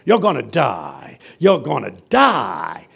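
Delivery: slow, drawn-out pronouncement; pastor's voice. Retold or imitated. Pastor warning the seated students, slow emphatic preaching. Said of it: "he talks like that".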